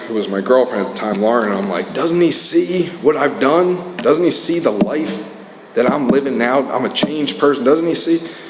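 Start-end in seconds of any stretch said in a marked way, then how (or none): 1.9-8.4 retold frustrated protest, pitched high; angry exasperation. Upset father venting to his girlfriend about his son, exasperated. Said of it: "I would get so angry".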